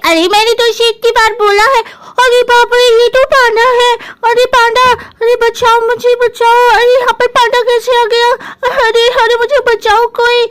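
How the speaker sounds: high-pitched cry for help; panicking big sister. Acted. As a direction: play the alarmed sister calling for rescue.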